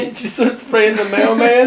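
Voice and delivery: slow, laughing, high and loud. Real, not acted. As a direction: laugh through the question. Laughing joke about spraying mailman.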